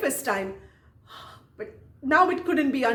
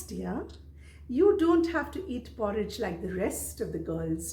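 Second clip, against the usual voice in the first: sweetly condescending teacher; 2 semitones lower, 6 dB quieter than usual. Retold or imitated. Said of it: "smiling all the time".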